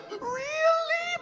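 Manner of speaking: put-on very high, teary little-girl gasp; mock tearful child; hopeful crying girl. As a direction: squeak it through tears.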